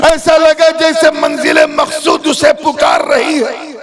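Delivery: loud, high rising sermon; impassioned preaching crescendo; preaching climax. Acted. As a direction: build to a fervent peak.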